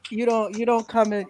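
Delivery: usual speaking voice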